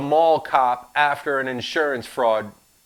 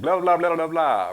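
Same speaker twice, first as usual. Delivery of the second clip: dismissive blah blah; 5 semitones higher, 5 dB louder than usual. Acted. Skipping text dismissively.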